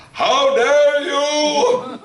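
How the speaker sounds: drawn-out, high-pitched indignant cry; hammed-up, slow squeal of indignation; mock outrage. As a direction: stretch each word way up high in theatrical outrage. Retold outrage at price rise.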